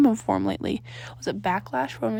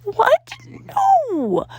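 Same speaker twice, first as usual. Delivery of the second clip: high, loud startled No; shouted denial; 6 dB louder than usual. A narrator's reading. Danny shouting a denial at his friends, high and loud. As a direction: blurt it out in shock. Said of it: "he shouted".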